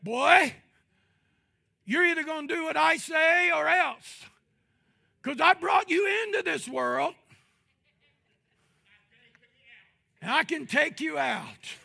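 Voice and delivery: loud, high warning to a son; stern father threat. Retold or imitated. Father warning his disobedient boy, loud stern shout. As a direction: bellow like a father laying down the law. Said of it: "a father, maybe say that".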